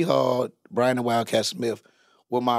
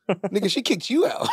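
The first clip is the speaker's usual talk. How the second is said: laughing retort; 6 semitones higher than usual. Retold or imitated. Retold argument in car.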